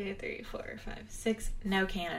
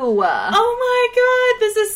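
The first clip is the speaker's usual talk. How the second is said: excited squeal; 14 semitones higher, 10 dB louder, slower than usual. Pretend. Overjoyed mock reaction.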